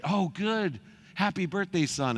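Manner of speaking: high, chirpy mock dad voice; dad cheerfully brushing off his son's complaint; imitating his dad. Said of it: "I called my dad".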